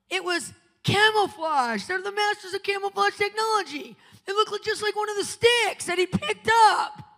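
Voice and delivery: high, loud fervent preaching; rising sermon fervour. Acted. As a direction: preach it hard to the congregation. Preaching at full force.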